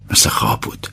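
whispered as if in a dream; dreamy whisper. A narrator's reading. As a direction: breathe it out in a whisper, awestruck. Narrator's hushed aside of wonder.

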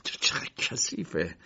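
slow, whispered disgust. Acted. K recoiling from filthy old books, whispered disgust. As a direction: breathe out the words in revulsion. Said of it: "با بیزاری سرش را تکان داد".

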